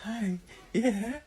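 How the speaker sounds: squeaky doggy talk with kissy sounds. Real, not acted. Cooing to the puppy.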